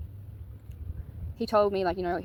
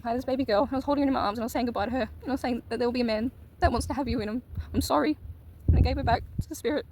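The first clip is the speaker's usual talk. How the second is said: higher, tearful voice holding it together; emotional, near tears; 4 semitones higher, faster than usual. Real, not acted. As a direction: fight back tears while saying goodbye. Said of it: "that was so emotional".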